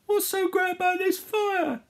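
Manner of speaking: high, loud wail; alarmed cry. Acted. Harold in burning London, high indignant panic. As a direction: shout it in storybook panic.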